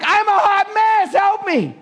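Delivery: very high, slow, wailing cry for help; mock desperate plea. Churchgoer finally admitting the truth, high slow wail. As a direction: beg to be rescued, drawn out. Voicing honest confession.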